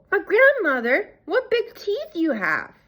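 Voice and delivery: put-on high child's voice with a slight tremble; a touch of fear creeping in; quivering slightly. A narrator's reading. The girl, growing scared, remarks on grandmother's teeth with a slight quiver. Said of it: "her voice quivering slightly".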